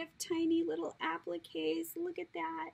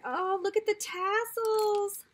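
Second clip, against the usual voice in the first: ordinary talk, nothing marked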